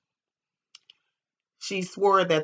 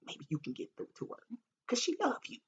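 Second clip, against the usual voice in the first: coaxing; 7 dB quieter than usual. Retold or imitated. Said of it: "I'm like".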